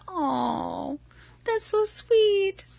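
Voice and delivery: high, soft, slow swooning coo; gushing like a total chick. Retold or imitated. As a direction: swoon over it like a Lifetime movie. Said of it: "being like a total chick and going".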